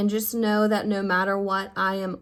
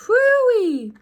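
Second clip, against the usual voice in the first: high, loud whoop to shake off tears; a big squealing whoo-wee to reset the mood; sudden loud high whoop; 10 dB louder than usual. Real, not acted. Switching gears, big exclamation.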